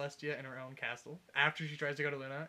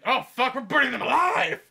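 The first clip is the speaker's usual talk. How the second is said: high, very loud burning-alive scream; panicked scream; 10 semitones higher, 18 dB louder than usual. Acted. Soldiers in their first clash, screaming in mock panic. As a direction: scream it as an acted cry of horror. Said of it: "So they're all like".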